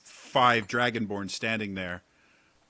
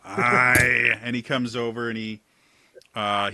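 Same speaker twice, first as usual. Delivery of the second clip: normal talking voice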